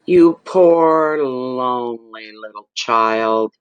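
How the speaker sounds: taunting a troll with hammed-up sorrow; mocking pity. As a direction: drip with sarcastic pity, stretching each word. Reading a troll's comment mockingly.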